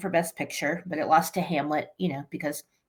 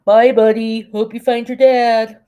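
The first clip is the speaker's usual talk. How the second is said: cheerful cartoon voice; 4 semitones higher, 10 dB louder than usual. Quoting the narwhal from Elf.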